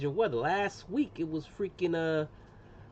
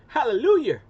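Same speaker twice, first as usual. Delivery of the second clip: loud, high jubilant cry; mock hallelujah; 8 semitones higher, 11 dB louder than usual. Host jokingly celebrating connecting people, loud and exultant. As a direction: belt it out in playful triumph.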